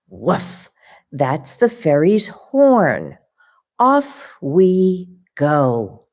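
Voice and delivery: lively, slow picture-book reading with puppy noises; reading to children. Acted. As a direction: read it like bedtime story time for little kids.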